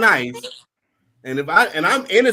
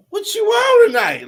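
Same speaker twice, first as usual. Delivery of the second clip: high playful voice; 8 dB louder than usual. Retold or imitated. Friend asks in high voice.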